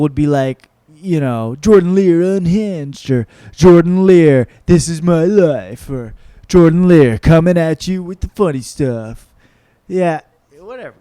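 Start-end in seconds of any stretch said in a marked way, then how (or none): 1.6-3.1 big, drawn-out announcer parody; mock special title. Comedian mocking others' special titles, hammed-up announcer. 3.5-5.9 loud, hammed-up announcer parody; mock special title. Comedian mocking others' special titles, hammed-up announcer. 6.5-9.3 loud, hyped-up announcer parody; mock special title. Comedian mocking others' special titles, hammed-up announcer.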